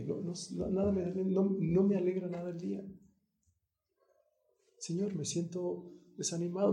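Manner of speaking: soft, downcast plea to God; a believer confiding sadness to God; sad prayer. Pretend.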